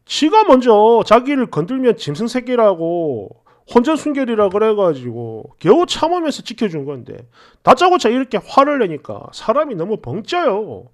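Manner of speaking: plain reading voice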